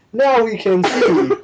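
hammed-up TV narrator, drawn out; milk it like a reality-show narrator; heavy narrator voice. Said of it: "In that very narrator heavy voice".

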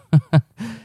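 short, high-pitched laugh; real chuckle. Real, not acted. After admitting heavy breathing in a chair, chuckling. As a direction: chuckle at your own silliness.

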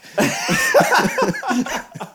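airy high laugh; high breathy laugh. Real, not acted. Laughing after joke.

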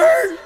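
a quick, high squeal like a record scratching to a stop; record scratch noise. Retold or imitated. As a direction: voice the needle screeching off the record, lightly mocking. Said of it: "the D J, like, the, the record".

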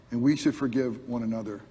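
ordinary talk

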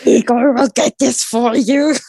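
eager hunchback helper voice; Igor. Pretend. Plays Igor the hunchback servant.

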